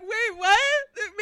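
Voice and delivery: high, loud, acted-out astonishment; mock stunned disbelief. Reenacting being told a fan loves their podcast, mock stunned disbelief. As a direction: squeak it like you cannot believe it. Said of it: "that's literally how it sounded".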